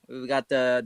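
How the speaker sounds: ordinary talk, nothing marked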